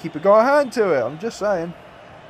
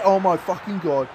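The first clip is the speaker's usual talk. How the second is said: ordinary talk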